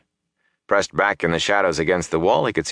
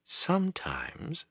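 very soft, slow, higher woman's voice; quiet; 10 semitones higher, 12 dB quieter, much slower than usual. A narrator's reading. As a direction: say it quietly, drawn out. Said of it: "Celine said quietly".

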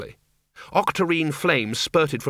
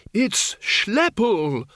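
high, stretched-out shout; 10 semitones higher, much slower than usual. A narrator's reading. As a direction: shout it slowly at a raised pitch. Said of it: "shouted Windle".

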